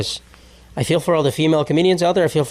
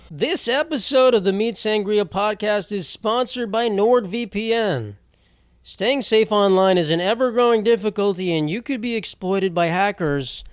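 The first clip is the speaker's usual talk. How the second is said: measured, raised-pitch sponsor read; podcast ad read; 4 semitones higher than usual. Acted. Host reading listeners a NordVPN sponsor spot, scripted ad read. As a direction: read the sponsor copy out deliberately.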